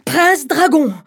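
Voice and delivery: high, loud bride's command. Acted. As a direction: order the dragon boldly. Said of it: "répondit la fille du berger".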